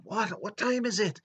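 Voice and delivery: high-pitched, startled boss voice; woken-up boss. Retold or imitated. Boss woken up by his security man, high startled protest. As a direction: put on a high, indignant voice.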